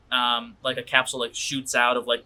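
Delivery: ordinary talk, nothing marked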